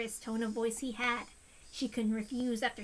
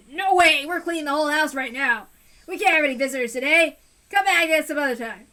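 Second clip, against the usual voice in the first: angry yelling; 3 semitones higher, 11 dB louder than usual. Acted. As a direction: bark at the caller to leave you alone. Teppei refuses visit.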